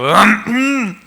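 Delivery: mock noncommittal "mm" grunt; closed-mouth mock grunt of assent; imitated grunt. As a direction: grunt it in place of an amen. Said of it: "경상도 나오니까 응을 잘하더라는".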